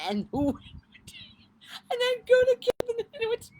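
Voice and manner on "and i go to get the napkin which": much higher, louder and fast, cracking up; helpless laughing retelling; laughing hard. Real, not acted. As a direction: choke through the words with laughter.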